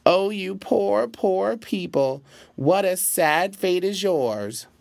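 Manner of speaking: high, slow mock wailing lament; fake crying. A narrator's reading. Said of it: "with tears falling from his eyes and crying".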